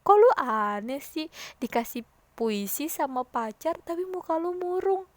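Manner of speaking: loud start, then rising incredulous question; teasing disbelief. Acted. Student needling a friend who looks gloomy over a love poem, teasing disbelief. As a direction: stretch it out and climb in disbelief. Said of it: "pasti kamu pasti bilang kalau temen kamu kayak gitu".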